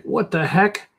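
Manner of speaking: hammed-up bewildered outburst; mock freaking out. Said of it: "She's freaking out".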